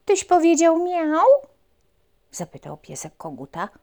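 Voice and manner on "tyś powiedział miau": high, loud little dog asking; a puppy's eager question. Acted. Puppy asks rooster.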